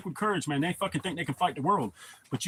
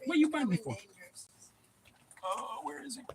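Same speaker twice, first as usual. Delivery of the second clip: pet talk; 8 semitones higher, 6 dB quieter than usual. Real, not acted. Asking the dog what he's after, high hushed coo.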